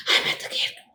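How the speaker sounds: mock out-of-breath, voiceless gasping words; imitating someone panting after a run. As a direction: act winded on the phone, very soft and airy.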